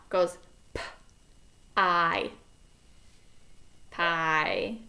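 "P, I" then "pie" blended; sounding out. Shown as a demonstration. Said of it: "we're gonna do one with two sounds now".

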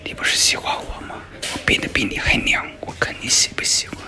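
hushed, unvoiced scheming aside; secretive whisper to yourself. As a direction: whisper it privately, plotting.

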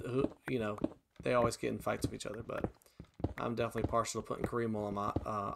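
ordinary talk, nothing marked

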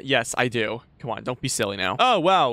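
ordinary delivery

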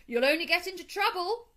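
high, loud warning; calling out. A narrator's reading. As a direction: shout a caution after him. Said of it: "called the Rat".

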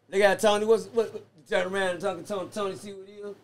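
usual speaking voice

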